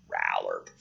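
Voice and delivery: very low, soft frog croak; deep amphibian burp of a call. Acted. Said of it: "it sounds like".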